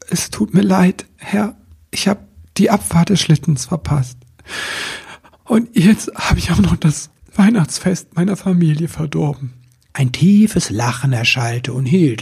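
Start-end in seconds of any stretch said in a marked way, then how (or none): 0.1-9.5 high-pitched, halting, timid apology; contrite. A narrator's reading. Elf confesses his failure to his master, contrite. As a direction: confess in a small, high, sorry voice. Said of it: "Er hielt den Kopf gesenkt und sprach".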